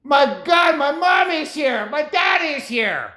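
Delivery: put-on delighted child seeing his parents arrive; hammed-up child; joyful kid. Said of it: "it means".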